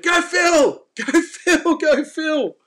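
high, loud cheering yell with a laugh; excited high shout of encouragement from the boat; retold yelling. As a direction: cheer your mate on as the shark runs. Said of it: "I start yelling out".

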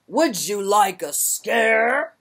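a slow, loud growling giant. Acted. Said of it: "The giant growled".

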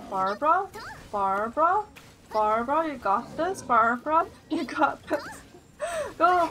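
root for her like a fan at the sideline; cheering on. Real, not acted. Cheering on character Barbara.

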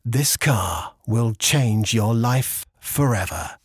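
slow close-mic ad voice; intimate commercial read. Shown as a demonstration. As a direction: savour every word of the ad line. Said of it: "a line in a commercial".

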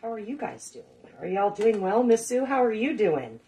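normal talking voice

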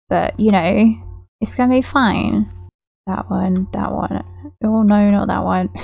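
normal talking voice

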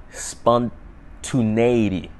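spontaneity, sounded out very slowly syllable by syllable; spontaneity pronounced slowly as if testing the word; careful pronunciation. Shown as a demonstration. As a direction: drag out spontaneity, unsure of it. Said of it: "Is that how you, how you fucking say it?".

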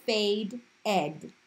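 "fade ed" in two distinct parts; ed as syllable. Shown as a demonstration. Teacher leading class to say it, split as "fade ed". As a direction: sound out "fade", then "ed" separately. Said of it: "Say it with me".

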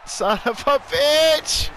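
a slow, pained laugh into a curse; disbelieving laugh. Real, not acted. Losing fan laughs at the winning kick, slow and pained. Kicker nails game winner.

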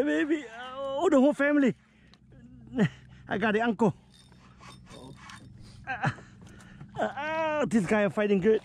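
usual speaking voice

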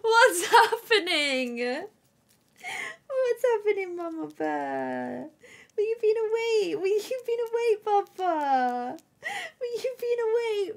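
put-on high, slow baby-voice whimper as her puppy; voicing the dog. Owner voicing her puppy's confused baby talk. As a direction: squeak it out like a confused little pup. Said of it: "She's like".

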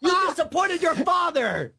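high wailing shout of a heartbroken father; fake crying dad. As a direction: overwrought dad sobbing his disappointment. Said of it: "fake dad".

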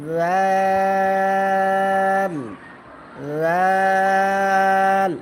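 each জাল held long on a level note; thin, drawn-out zal. Shown as a demonstration. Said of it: "দুটা দাঁতের আগার সাথে". (জাল পাতলা, লম্বা টেনে)

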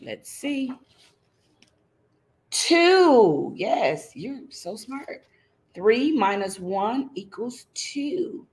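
ordinary delivery

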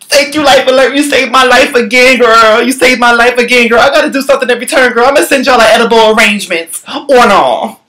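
high, gushing, rushing thanks; high excited thank-you. Pretend. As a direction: overflow with grateful relief. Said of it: "I'm like".

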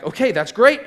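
delighted reaction. Retold or imitated. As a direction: replay an excited yes. Reacting to uncle's loan offer.

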